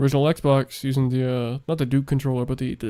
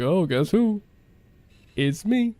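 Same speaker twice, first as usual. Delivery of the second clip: playful surprise entrance; 5 semitones higher, slower than usual. Acted. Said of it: "and you're like".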